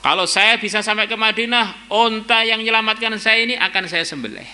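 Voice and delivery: lightly voiced woman's vow, pitched high. Escaped woman vowing to sacrifice the camel, high-pitched vow. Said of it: "Wanita tadi bernadar".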